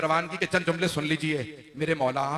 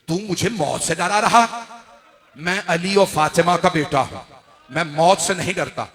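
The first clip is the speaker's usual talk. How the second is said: louder, forceful defiance; raised voice; 7 dB louder than usual. A narrator's reading. Imam answers a death threat, raised defiant voice. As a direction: raise your voice in firm defiance. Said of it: "मौला का आवाज का बुलंद करना था".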